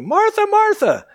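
"Martha, Martha" called high and loud; urgent double call. Acted. Jesus calls Martha twice, high and loud. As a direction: call her name twice to get her attention. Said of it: "When he says your name twice".

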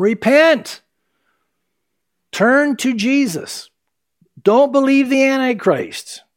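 high-pitched, imitated prophetic cry; urgent warning. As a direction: call out a warning to the crowd. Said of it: "They're warning people".

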